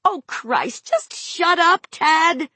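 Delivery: high, drawn-out angry plea; mother at the end of her rope; exasperated yell. A narrator's reading. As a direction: lose patience and yell it, stretching the words. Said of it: "she yelled at him".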